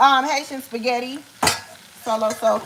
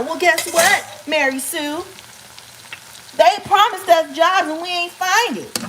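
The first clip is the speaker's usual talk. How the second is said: higher-pitched comeback; mock indignant retort; 7 semitones higher than usual. Answering imagined Mary Sue about promised jobs, mock indignant. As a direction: answer back with playful indignation.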